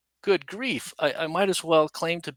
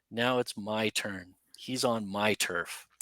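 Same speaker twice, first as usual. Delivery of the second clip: slow, hushed whisper, savouring the payback; whispered gloat; 5 semitones lower, 8 dB quieter, slower than usual. Retold or imitated. Soldier gloats over sleeping tormentor, slow hushed whisper. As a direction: breathe it out slowly like a secret revenge.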